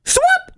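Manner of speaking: loud high forehand grunt; effort grunt. Pretend. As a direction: yell it as you swing. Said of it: "what's your forehand".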